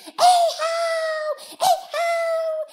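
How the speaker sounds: very high, loud shout; little boy's shrieked fish call; excited boy calling. Acted. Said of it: "every time he sees a fish, he says".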